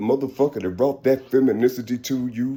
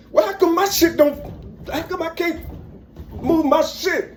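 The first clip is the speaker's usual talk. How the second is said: high-pitched, baffled protest; comic squeal of unfair treatment; mock indignant complaint; 13 semitones higher than usual. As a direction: whine in hammed-up frustration. Acts out own complaint about wings.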